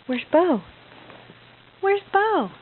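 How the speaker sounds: ordinary talk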